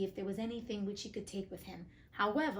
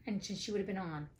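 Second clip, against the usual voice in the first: ordinary delivery, nothing marked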